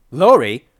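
loud, high call of her name; urgent shout. A narrator's reading. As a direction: shout to rouse someone in a daze. Calling louder after a slap.

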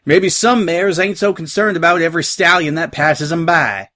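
pointed, twangy retort; bickering country mare. Acted. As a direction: needle a friend in a country accent. Voices the bickering mares.

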